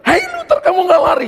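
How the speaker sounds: very high, urgent call; high-pitched retold shout. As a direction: imitate calling after someone running off. Acted call to fleeing friend.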